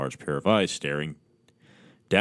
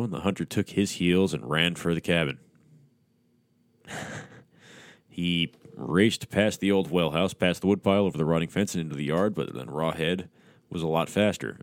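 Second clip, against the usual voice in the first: ordinary delivery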